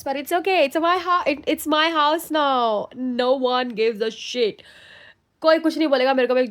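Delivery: loud, high and thrilled; gushing over her new house; excited. Real, not acted. Thrilled about her own house.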